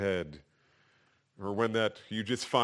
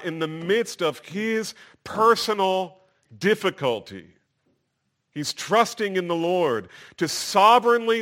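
higher, louder pulpit proclamation; emphatic preaching; 7 semitones higher, 7 dB louder than usual. Real, not acted. Preacher tells congregation David trusts the Lord, emphatic. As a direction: drive the point home with force.

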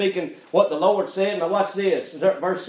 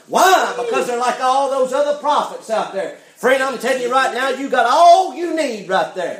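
louder, insistent exhortation; full-force preaching; 5 semitones higher, 5 dB louder than usual. Real, not acted. Preacher warning friends about false prophets, full-force preaching. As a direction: hammer it home to the congregation.

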